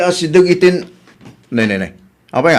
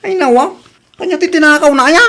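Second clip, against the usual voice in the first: surprised; 8 semitones higher, 5 dB louder than usual. Acted. Surprised reaction to theft news.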